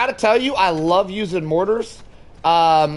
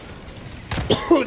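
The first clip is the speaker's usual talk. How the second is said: dry cough; 9 semitones higher, 3 dB quieter than usual. Real, not acted. Dust in throat, coughing.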